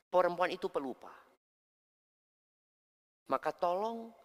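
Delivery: usual speaking voice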